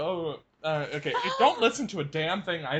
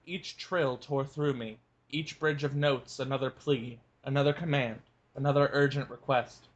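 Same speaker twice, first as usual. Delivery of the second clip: lower, measured book reading; story narration; 6 semitones lower than usual. A narrator's reading. Cohost reads the novel passage to listeners, calm narration. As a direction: read the scene calmly as a narrator.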